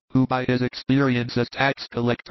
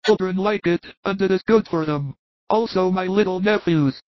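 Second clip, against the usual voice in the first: higher-pitched, agreeable man; obliging Thomas; 7 semitones higher than usual. Acted. Thomas offers his flask to the child, obliging. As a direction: offer it helpfully.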